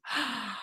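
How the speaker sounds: a sharp, breathy high gasp. Real, not acted. Reacting to Tiffany's comment with a soft gasp. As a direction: gasp in delighted shock.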